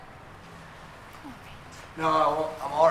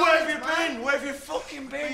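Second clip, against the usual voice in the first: furious shout; 5 semitones higher, 4 dB louder than usual. Acted. Woody shouting at his mates where they've been, furious. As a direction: yell it high and loud at them.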